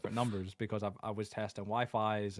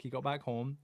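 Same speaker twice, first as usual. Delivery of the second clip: ordinary delivery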